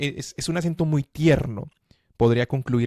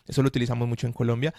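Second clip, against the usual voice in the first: normal talking voice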